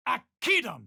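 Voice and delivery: high sharp incantation; spell cry. Acted. Adventurer casting a spell, sharp high cry. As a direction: fling the word out high.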